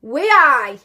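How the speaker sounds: loud, high Geordie "way-aye". Shown as a demonstration. Mum coaching her daughter to copy, loud Geordie. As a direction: call out a broad Geordie phrase. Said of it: "you say I".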